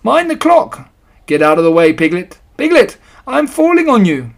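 loud high warning shout; cry of warning. A narrator's reading. Pooh warning Piglet as the house tips, loud cry. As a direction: yell it to save your friend. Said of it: "cried Pooh".

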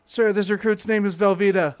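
loud, high recruit shout; barked sir yes sir. Retold or imitated. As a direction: bark it at attention. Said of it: "I want you to tell me".

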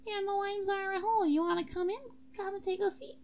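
high-pitched student character voice; Zara Hall. Shown as a demonstration. Invented student inviting a visitor in, high character voice. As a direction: introduce yourself in a put-on high voice. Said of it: "Maybe she talks like this".

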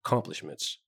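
ordinary delivery, nothing marked

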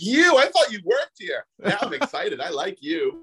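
high, drawn-out excited imitation; hammed-up high-pitched welcome; delighted recognition. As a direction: gush as if pleasantly surprised to see them. Acting out a delighted casting welcome.

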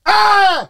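short, high, loud yelp; sudden scream. Retold or imitated. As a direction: imitate a startled scream into the mic. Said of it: "it's you just going".

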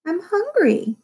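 high-pitched sister's protest; protesting claim. A narrator's reading. Sister defending her four taco shells, protesting. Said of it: "she claimed".

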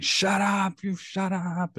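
high-pitched mock screaming; retold scream. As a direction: imitate a girl screaming at a preacher. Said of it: "was straight up screaming".

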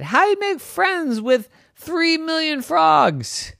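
high and slow disbelief; Wendy asks the neighbor, high and amazed; incredulous Wendy. Acted. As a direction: stretch it out, baffled.

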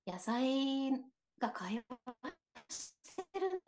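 garbled, breaking-up connection audio; words chopped by a dropping line; glitching alien voice. Real, not acted. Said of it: "ちょっと宇宙人が喋ったと思うんですけど".

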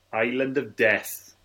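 usual speaking voice

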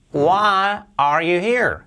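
"Why are you here?" said slowly, falling at the end; slow teacher demo; slow example. Shown as a demonstration. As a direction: model the question slowly. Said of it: "para decir".

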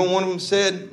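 ordinary talk

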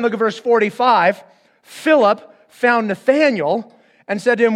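ordinary delivery

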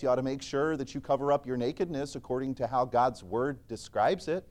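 normal talking voice

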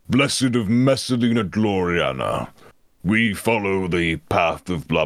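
slow solemn zealot. Shown as a demonstration. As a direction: intone it like a zealot. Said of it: "original character was kinda like".

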